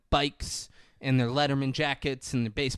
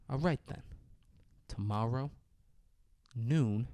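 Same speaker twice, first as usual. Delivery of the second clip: soft, paused-out threat; mock movie standoff; slow quiet challenge; 2 semitones lower, 9 dB quieter than usual. Rival sets the showdown.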